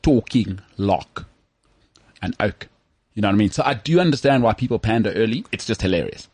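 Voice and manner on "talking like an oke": slow, exaggerated South African oke; broad South African accent. South African not understood in London, slow broad accent. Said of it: "they don't understand what you're saying".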